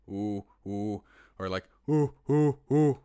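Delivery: low hooting ooh like a monkey; low monkey noise. Shown as a demonstration. Teaching viewers the low monkey noise, hooting. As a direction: hoot it out, low and round. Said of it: "get your voice right in that tone".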